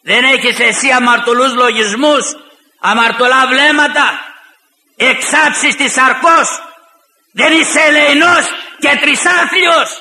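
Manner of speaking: rising fiery rebuke, ending in a shout; impassioned Orthodox sermon; preaching at full force. Acted. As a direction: thunder at the proud sinner.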